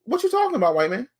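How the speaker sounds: high, incredulous sitcom catchphrase; Arnold Jackson catchphrase. Retold or imitated. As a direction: throw it back like the sitcom kid. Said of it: "I went straight Arnold Jackson".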